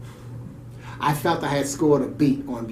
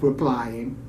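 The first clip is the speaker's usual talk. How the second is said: ordinary talk, nothing marked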